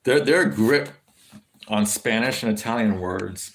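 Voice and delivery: ordinary delivery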